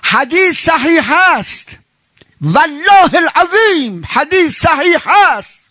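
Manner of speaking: high, slow, insistent sermon voice; slow fervent pulpit insistence; emphatic preaching. Acted. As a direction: drive home that the hadith is sound.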